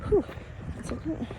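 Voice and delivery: breathing hard between words; winded, catching her breath; heavy breathing. Real, not acted. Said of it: "Sorry about my breathing".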